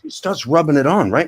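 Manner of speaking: ordinary talk, nothing marked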